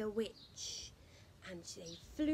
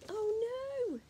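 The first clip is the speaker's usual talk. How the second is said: high, loud "oh no"; shocked storyteller exclaiming; mock alarm, high and loud; dismayed cry; 10 semitones higher, 3 dB louder than usual. Reader exclaims at broom snapping.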